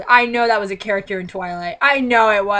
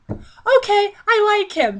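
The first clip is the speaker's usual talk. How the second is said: put-on high, giddy girly voice; hammed-up smitten confession; mock swooning heroine; 11 semitones higher, much slower than usual. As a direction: gush it like a lovestruck teen.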